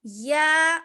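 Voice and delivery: loud letters, with جیم and میم held long; teacher letter drill. Shown as a demonstration. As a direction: call out each letter for the class. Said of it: "کیسے ادا کرنا ہے".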